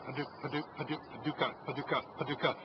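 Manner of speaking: quick stammered duck chatter; duck feeding chuckle. Shown as a demonstration. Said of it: "I try to say the word paducah".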